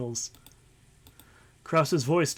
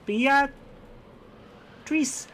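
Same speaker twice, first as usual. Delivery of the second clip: put-on little girl voice; 8 semitones higher, much slower than usual. Voicing a character again.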